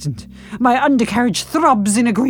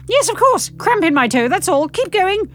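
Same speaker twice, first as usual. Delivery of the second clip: flustered; 10 semitones higher than usual. Acted. Flustered covering lie.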